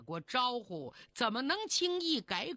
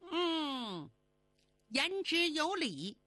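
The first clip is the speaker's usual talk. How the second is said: agreeing fairy; 6 semitones higher, slower than usual. Acted. Fairy agrees after advice.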